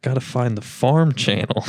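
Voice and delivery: quoting own dream self while cracking up; laughing retelling of a sleepwalking quest; laughing through it. Retold or imitated. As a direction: say it sure of the mission, giggling. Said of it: "It's like".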